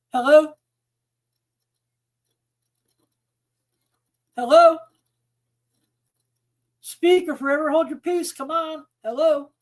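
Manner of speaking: loud, rising hello into a silent line; calling out loud. Real, not acted. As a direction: call out to a caller who won't answer.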